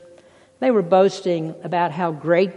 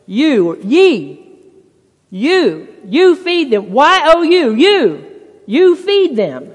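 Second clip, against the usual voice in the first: each "you" punched louder and higher, building to "you feed them"; stress on YOU; 4 semitones higher, 6 dB louder, slower than usual. Shown as a demonstration. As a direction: hammer the word "you" again and again, rising. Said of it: "Jesus placed the emphasis on".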